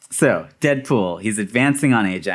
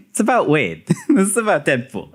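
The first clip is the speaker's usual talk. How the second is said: normal talking voice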